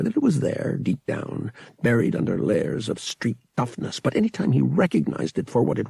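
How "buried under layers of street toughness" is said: usual speaking voice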